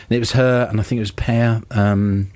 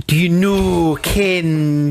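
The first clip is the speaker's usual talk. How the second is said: higher-pitched mock Scottish accent; bad Scottish accent; 7 semitones higher, much slower than usual. Radio host mocking Moira Shearer in Whiskey Galore, bad Scottish accent. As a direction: do a badly faked Scottish accent. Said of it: "the worst Scottish accent in fiction".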